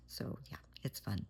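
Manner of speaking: dry sarcastic whisper. Real, not acted. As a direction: breathe it out, deadpan. Wry aside about sleepless night.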